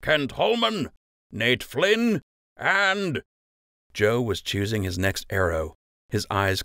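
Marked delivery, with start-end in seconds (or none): 0.0-3.2 higher, ringing roll call of names; Master Fletcher calling names. A narrator's reading. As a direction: announce each archer to the field. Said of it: "Master Fletcher called".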